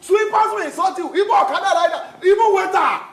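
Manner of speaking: angry rant. Acted. Customer rants at waiter.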